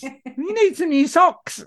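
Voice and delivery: high, slow put-on mum voice; mock mum. Mother imitating a nagging mum about socks. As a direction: nag like a fussy mother.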